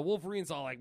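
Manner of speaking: ordinary talk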